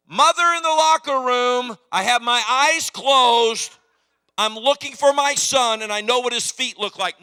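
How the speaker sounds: loud, high announcing mother; mother barging in. Retold or imitated. Mother kicks open the locker room door, loud announcement. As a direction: call it out as you barge in.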